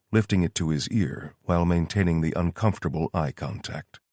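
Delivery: usual speaking voice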